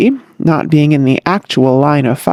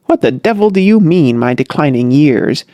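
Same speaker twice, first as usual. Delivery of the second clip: ordinary delivery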